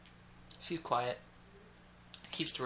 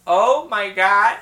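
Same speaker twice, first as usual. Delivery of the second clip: slow, much louder shocked gasp; mock gasp; 6 semitones higher, 16 dB louder, much slower than usual. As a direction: drag the shock out. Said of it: "Voices were spot on".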